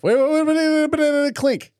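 high-pitched rapid waits, stopping a toast; sudden high call to hold on and clink first; urgent high wait. Real, not acted. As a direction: squeal wait wait wait before the clink.

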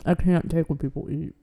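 talking while chewing candy; words round a mouthful of gummies; eating sour candy. Real, not acted. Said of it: "I'm eating sour gummies".

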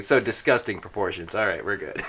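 muttered thought. Acted. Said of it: "The old man's thinking".

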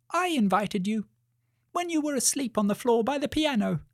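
high, squeaky little mouse voice. Acted. Mouse owning up to the invitation, high and squeaky. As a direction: pipe up as a small mouse.

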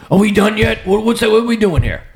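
high-pitched impatient dad impression; impatient father. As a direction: pester them to wrap it up, voice pushed high. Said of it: "when he was alive, what he would do".

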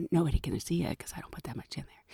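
fast, soft, secretive whisper; imitated whisper. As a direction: hush it like a guilty colleague. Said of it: "But she's like".

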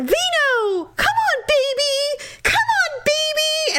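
very high-pitched mock calling to a dog; imitated screaming. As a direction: mimic an owner hollering for her dog across a room. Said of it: "screaming across the restaurant".

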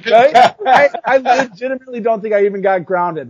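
ordinary delivery

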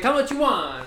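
high, loud mock Italian accent. Boss offering payment, mock Italian accent. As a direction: ask it like the Italian boss haggling. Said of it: "It's like".

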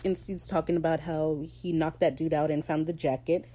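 ordinary delivery

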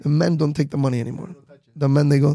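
usual speaking voice

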